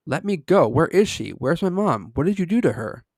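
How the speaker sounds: loud, high, furious yelling; furious scream. Acted. Kid thrashing against strangers, screaming in fury. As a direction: scream at them, scared and enraged. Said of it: "but I was furious".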